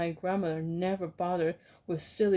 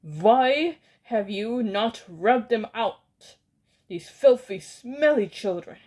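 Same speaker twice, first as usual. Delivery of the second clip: furious scream; 4 semitones higher, 7 dB louder than usual. Acted. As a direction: hurl the question at them in rage. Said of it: "she screamed".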